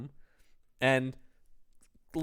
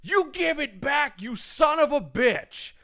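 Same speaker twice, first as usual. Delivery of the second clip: mock angry Victor; 8 semitones higher, 4 dB louder than usual. Victor demanding his things back, a hammed-up furious shout. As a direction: rage like a petty man wanting his stuff back. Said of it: "he's just like".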